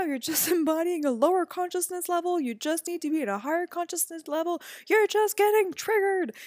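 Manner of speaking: high, loud mock lecture of a self-righteous coach; mocking preachy coach voice. Mimicking mom's preachy coach-speak in a fight. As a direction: parody a know-it-all coach scolding. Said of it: "that can be literally like".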